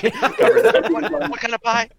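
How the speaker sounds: high laughing squeal. Pretend.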